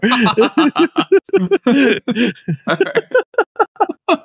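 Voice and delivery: high, drawn-out helpless laughing; long laugh. Real, not acted. After voicing power-hungry goblins, long high laugh. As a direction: keep cracking up for several seconds.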